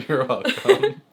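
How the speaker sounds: high, slow, laughing reply; real laughter spilling through the words; laughing to tears. Real, not acted. Answering partner's thanks for the donut, laughing to tears. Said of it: "Are you crying?".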